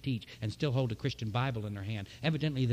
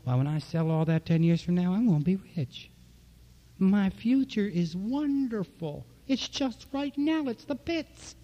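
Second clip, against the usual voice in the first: rising high and loud, then slowing to a sigh; retold excited boast; 5 semitones higher, 3 dB louder than usual. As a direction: tell Don you'll be rich, then deflate. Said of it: "I said to Don".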